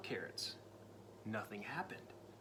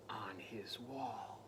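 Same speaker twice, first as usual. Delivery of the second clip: slow, drawn-out whisper; 5 semitones lower, 8 dB quieter, much slower than usual. A narrator's reading. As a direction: let it hang in a whisper. Suspense narration.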